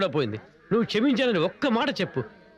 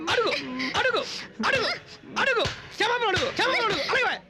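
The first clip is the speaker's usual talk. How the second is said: much higher, shouted క్షమాపణ అడుగు; shouted demand; 10 semitones higher than usual. Acted. Father shouting at his daughter to apologise, high shouted order. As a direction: yell the order at her, pitch way up.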